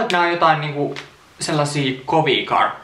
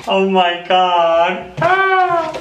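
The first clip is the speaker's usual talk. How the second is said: loud high squeal, slow on the first words; excited scream; 7 semitones higher, 5 dB louder, much slower than usual. Real, not acted. Opening a tea parcel, squealing loudly. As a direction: shriek with delight. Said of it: "mä rupean huutaa".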